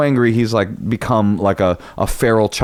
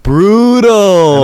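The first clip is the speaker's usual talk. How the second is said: loud, high, long drawn-out exclamation; big wailing reaction, falling in pitch; mock-horrified cry; 9 semitones higher, 6 dB louder than usual. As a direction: stretch it out in hammed-up dismay. Listener's mock-horrified reaction to insult.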